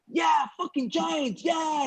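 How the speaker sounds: high, drawn-out mock rallying cry; hyped host. Host on stage to cheering Giants fans, hammed-up hype. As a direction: imitate an emcee whipping up fans.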